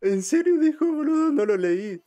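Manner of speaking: high loud disbelief; squealing shocked question; excited anime fan. Real, not acted. Reacting loudly to anime dialogue.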